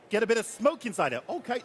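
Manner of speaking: normal talking voice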